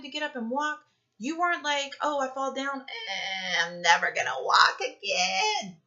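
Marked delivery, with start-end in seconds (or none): 2.0-5.8 mock wail, a lot higher and louder; whiny despair. Mocking the adult who quits after one fall, hammed-up wail. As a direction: ham up a fallen kid giving up forever. Defeated adult voiced as fallen child.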